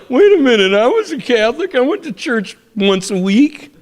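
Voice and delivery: high, loud, dismayed mimicry; mock protest. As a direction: imitate someone protesting in sudden disbelief. Imagined stunned Christians protesting.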